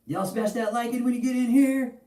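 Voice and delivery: high, pumped-up shout-out to the chat; hype call to viewers. Acted. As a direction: streamer hype voice calling for likes. Streamer calling viewers to like.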